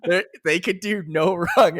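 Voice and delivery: usual speaking voice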